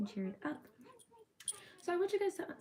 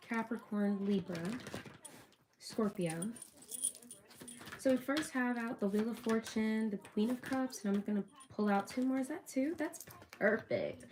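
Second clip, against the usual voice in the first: ordinary talk, nothing marked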